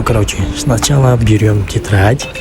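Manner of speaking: usual speaking voice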